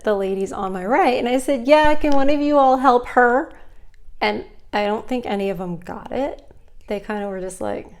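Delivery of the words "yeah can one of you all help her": raised, brighter voice calling across the counter; called out request. Retold or imitated. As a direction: call over to the other ladies, a bit louder. Calling to other tellers for help.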